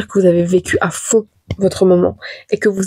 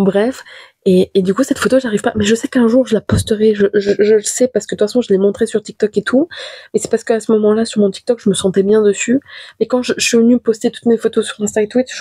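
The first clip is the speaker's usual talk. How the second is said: ordinary talk, nothing marked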